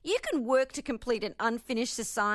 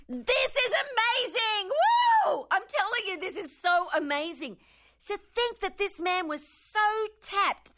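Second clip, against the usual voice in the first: excited; 9 semitones higher than usual. Real, not acted. Gushing over the mystic's words.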